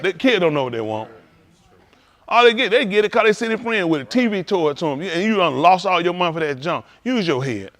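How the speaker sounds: usual speaking voice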